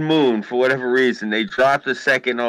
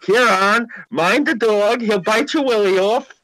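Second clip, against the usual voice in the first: higher-pitched mock Welsh mother; lilting, raised Welsh scolding; Welsh mum calling out; 8 semitones higher than usual. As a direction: imitate a Welsh mum warning her kid. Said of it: "she yells at her kid".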